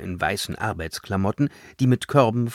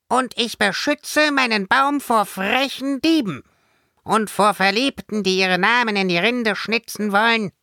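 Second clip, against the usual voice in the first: very high, proclaiming fairy voice; high-pitched, prim fairy protector; proud little fairy; 13 semitones higher than usual. A narrator's reading. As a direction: announce your guardian duty like a tiny fairy. Fairy proclaims her duty.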